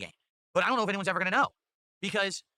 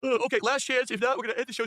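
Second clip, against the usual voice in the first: talking through a yawn; words stretched by a yawn; 6 semitones higher, 4 dB louder than usual. Real, not acted. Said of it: "Sorry, I got yawn out of nowhere".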